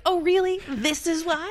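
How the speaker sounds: high, loud scoffing "oh really?"; sarcastic mock disbelief. Mocking the dad's excuse.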